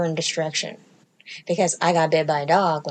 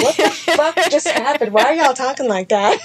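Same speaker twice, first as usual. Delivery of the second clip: higher, louder, laughing disbelief; baffled outburst; 5 semitones higher, 5 dB louder than usual. Retold or imitated. Comic playing herself baffled by dog lovers, laughing shout. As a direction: react appalled at the baby talk. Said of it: "and you're just like".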